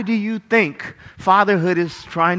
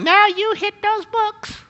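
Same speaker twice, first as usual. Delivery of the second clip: high, slow parent voice; nagging parent; 12 semitones higher, slower than usual. Retold or imitated. Parents to child about studying, high-pitched nagging. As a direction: draw out the order in a raised put-on voice. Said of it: "When your parents are going".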